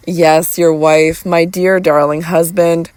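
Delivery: ordinary delivery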